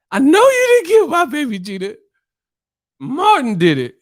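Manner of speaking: high-pitched scolding impression; Mama Payne. Mama Payne confronting Gina over the bird, high mock outrage. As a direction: start loud in falsetto, accusing. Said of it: "he was playing Mama Payne".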